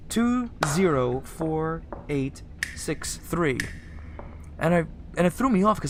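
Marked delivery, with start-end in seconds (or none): 0.1-3.6 slow, deliberate digit by digit; slow code read. Shown as a demonstration. Player slowly reading the code to viewers. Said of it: "That's the code".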